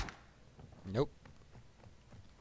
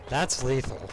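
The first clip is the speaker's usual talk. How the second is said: usual speaking voice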